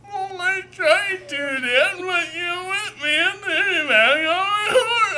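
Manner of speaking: put-on high, loud crying wail of a boy; blubbery whiny crying. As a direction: bawl it out at dad through tears. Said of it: "I was a blubbery little whiny crap".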